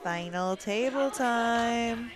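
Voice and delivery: stretched-out announcement, stress on table; slow announcer call. Shown as a demonstration. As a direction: drag it out like a ring announcer. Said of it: "I get to do that final table time".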